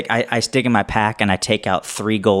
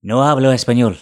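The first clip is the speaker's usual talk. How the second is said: put-on Spanish accent; 4 dB louder than usual. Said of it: "I have a Spanish accent".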